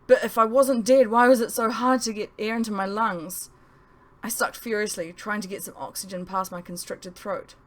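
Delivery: plain reading voice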